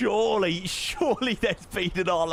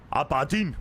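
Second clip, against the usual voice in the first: a hint of bad Scottish; lightly attempted Scottish accent; awful Scottish accent. Shown as a demonstration. Streamer trying out his one Scottish word, bad Scottish accent. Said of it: "Awful Scottish accent".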